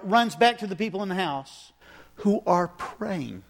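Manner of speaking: usual speaking voice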